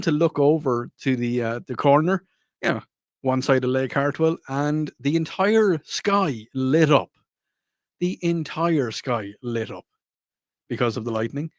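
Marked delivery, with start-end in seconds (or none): 4.5-7.1 slow, drawn-out for drama; slow dramatic. Real, not acted. Dramatic storytelling of lightning. 8.0-9.8 slow, drawn-out for drama; slow dramatic. Real, not acted. Dramatic repeat of line.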